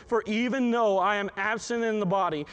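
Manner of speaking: normal talking voice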